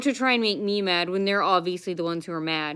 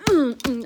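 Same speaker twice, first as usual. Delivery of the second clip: imitated hand slamming; 5 semitones higher, 4 dB louder than usual. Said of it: "like that".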